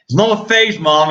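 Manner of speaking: high-pitched whiny protest, played up; mock teen protest. Streamer playing a kid protesting to mom, mock indignant.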